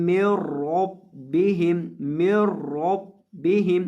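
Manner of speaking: من ربہم with held nasal meem and doubled ب; slow, heavy ر and hard ب in ربہم; ghunna and shadda. Shown as a demonstration. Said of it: "را کو اور با کو دونوں کو سختی کے ساتھ".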